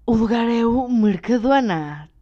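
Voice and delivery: loud, slow, big announcement; dramatic reveal. Acted. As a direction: unveil it like a prize. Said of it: "Vou-vos deixar com esta".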